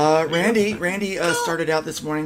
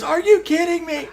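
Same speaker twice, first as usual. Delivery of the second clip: high, laughing outrage; laughing disbelief; 5 dB louder than usual. Retold or imitated. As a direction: squeak it out through a laugh. Victim's reply in retold movie.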